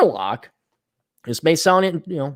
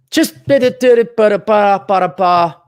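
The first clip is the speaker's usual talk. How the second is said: higher, louder nonsense babble; mock gibberish; 6 semitones higher, 5 dB louder than usual. Mimicking the flamboyant translator, gibberish babble. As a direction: scat the translator's flamboyant signing.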